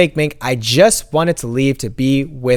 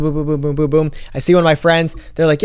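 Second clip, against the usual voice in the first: usual speaking voice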